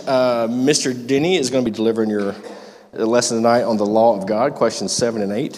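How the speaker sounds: normal talking voice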